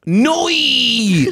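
Australian "Noi." said high with a diphthong, noy; mock Australian "Noi."; Australian accent. Shown as a demonstration. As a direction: drawl "Noi." like an Aussie would say no. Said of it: "let me do this in my Australian accent".

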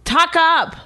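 mocking a rude command; rude bark. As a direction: bark the order like a jerk. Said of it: "Like a fucking asshole".

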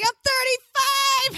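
high-pitched gleeful squeal; spring the trap in a high voice; hammed-up, gloating reveal; triumphant gotcha. Tops the gotcha punchline.

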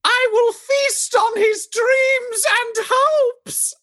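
very high, slow ominous shriek; drawn-out falsetto threat, loftily creepy; spooky screech. Acted. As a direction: shriek it like a menacing prophecy. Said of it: "you're real spooky".

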